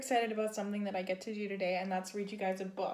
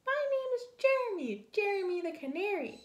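tiny, very high, quick squeak; Jeremy's little canary voice; 11 semitones higher than usual. Acted. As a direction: pipe up like a wee bird. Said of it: "a little voice spoke".

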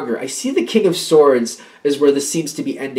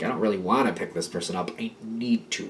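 low, much softer grudging aside; reluctant mutter; 5 semitones lower, 9 dB quieter than usual. Acted. Reluctant helper grumbling to themselves, softly. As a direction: say it under your breath, unwilling.

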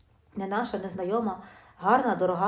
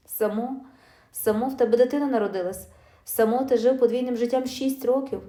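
louder, biting disbelief at his excuse; furious wife mocking his excuse; angry retort; 3 semitones higher, 6 dB louder than usual. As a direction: throw his own word back at him, louder. Wife confronts cheating husband.

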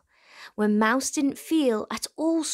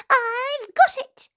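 high, loud squeaky eureka; a tiny mouse struck by an idea; excited mouse voice; 8 dB louder, slower than usual. A narrator's reading. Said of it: "he said".

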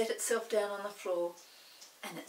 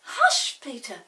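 sudden hush; 4 semitones higher, 11 dB louder, slower than usual. Acted. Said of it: "said Molly suddenly".

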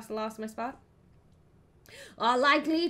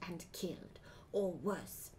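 whisper; 4 semitones lower, 9 dB quieter, slower than usual. A narrator's reading. Ominous aside in narration.

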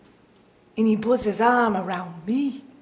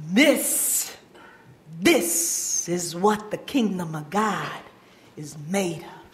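soft and lower, unhurried; tender; 6 semitones lower, 6 dB quieter than usual. Acted. Jesus telling the crowd about the boy, tender. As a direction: say it gently, like a blessing.

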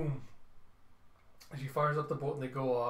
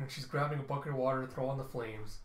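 plain reading voice

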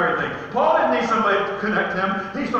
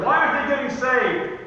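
raised, pleading repeat of the question; insistent question; 7 semitones higher than usual. Acted. Preacher voices worry over people he prayed for, insistent. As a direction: press the question higher and harder.

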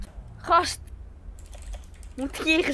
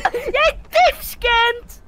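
high, loud, slow raging insult; furious yelling; 13 semitones higher, 8 dB louder, slower than usual. Real, not acted. Gamer raging at friend over voice chat. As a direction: yell it half laughing in rage.

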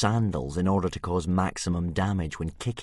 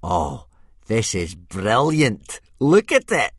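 breathless gloating; 4 semitones higher, 9 dB louder, slower than usual. A narrator's reading. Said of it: "when he finally got his breath back from laughing".

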